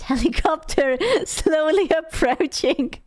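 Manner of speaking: breaking into giggles while talking; laughing. Real, not acted. Joking to viewers about the bright red helicopter, laughing.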